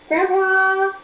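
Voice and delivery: high, held singsong call. Pretend. Calling Grandpa for the baby, singsong call.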